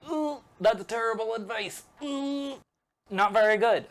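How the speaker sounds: high-pitched, mock-polite "ooh"; squeamish disapproval. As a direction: imitate someone wincing at bad advice. Anakin recoils at Yoda's advice.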